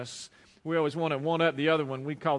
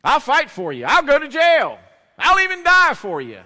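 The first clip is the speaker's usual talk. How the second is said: high, loud bragging shout; loud boasting Peter; 6 semitones higher, 10 dB louder than usual. Acted. Preacher acting Peter vowing loyalty to Jesus, loud and boastful. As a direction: puff up the chest and holler it out. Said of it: "he probably got a little bit loud".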